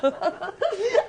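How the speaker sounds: high flattered giggle; giggle, pleased by the compliment. Acted. Cleaner giggles at the visitor's flattery.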